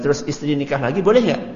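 ordinary delivery

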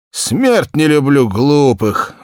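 loud, slow, high peasant grumble; grumbling Ignat. Acted. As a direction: drag it out loudly, fed up. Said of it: "вздохнул Игнат".

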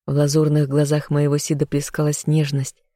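ordinary delivery

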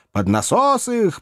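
high, drawn-out heckle; urging. A narrator's reading. Pieman advising the crowd, high drawn-out urging. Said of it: "посоветовал торговец горячими пирожками".

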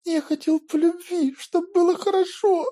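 very high, sob-choked words; choking on sobs. Acted. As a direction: force the words out between sobs. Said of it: "давясь рыданиями".

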